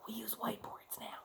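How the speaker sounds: soft unvoiced whisper; whispered aside. Real, not acted. Teacher whispering an aside to the class, hushed.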